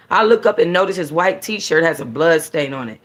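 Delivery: usual speaking voice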